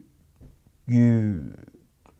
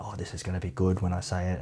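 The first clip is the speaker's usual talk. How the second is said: hushed, lower smug murmur to the mirror; smug self-satisfied; 5 semitones lower, 13 dB quieter, faster than usual. Retold or imitated. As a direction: quietly savour the line before saying it. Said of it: "in the mirror and be like".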